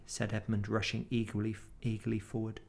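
ordinary delivery

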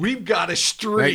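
slow, high, drawn-out mock demand; mock tough negotiator. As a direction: lean on it like a union boss. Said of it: "Move your tech".

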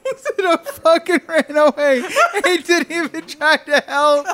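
high, loud, laughing through the words; laughing hard. Real, not acted. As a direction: barely get it out for laughing. Cracking up while retelling.

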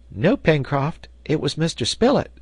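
put-on high-pitched young character voice; boy's answer. A narrator's reading. Herbert telling the sailor who found it, boyish answer.

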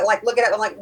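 ordinary talk

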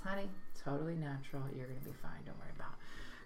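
soft, low motherly comfort; reassuring mom. Acted. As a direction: soothe the child, trailing into a hush. Mom reassures second son.

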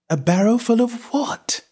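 puzzled Alice. A narrator's reading. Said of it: "thought Alice".

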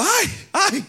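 high, hammed-up fleeing cry of ay ay; a joking high-pitched yelp of fright; mock yell.

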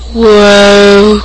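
ordinary talk, nothing marked

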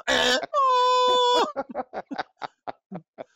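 cry-like high laughter; high shrieking laugh. Real, not acted. Laughter after joke.

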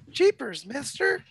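put-on squeaky, high gee-whiz kid voice; wholesome 1950s kid. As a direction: pitch it way up like a wide-eyed kid. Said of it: "Patches O'Houlihan".

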